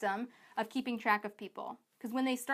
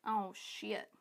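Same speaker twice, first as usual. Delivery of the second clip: hushed mock realization; 2 semitones lower, 8 dB quieter than usual. Coach voices most people realizing they can't keep track, hushed dismay. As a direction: act out a quiet, sinking realization.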